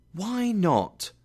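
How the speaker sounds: high, drawn-up question; insistent plea. A narrator's reading. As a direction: press him in puzzled disbelief. Nephew presses his question.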